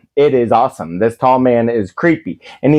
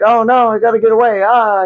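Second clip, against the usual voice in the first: high, fast, frightened rush; mock panicked kid; 8 semitones higher, faster than usual. Kid spotted by the tall man, fast scared blurt. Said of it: "the kid's like".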